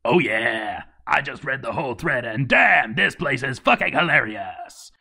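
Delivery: booming mascot voice reading the post; Kool-Aid Man voice. Retold or imitated. Narrator reads the fan post as Kool-Aid Man. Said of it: "Evil Kool-Aid Man posted".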